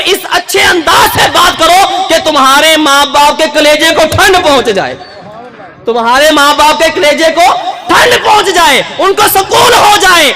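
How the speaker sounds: impassioned sermon shout; full-force preaching. Acted. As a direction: proclaim it to the whole hall.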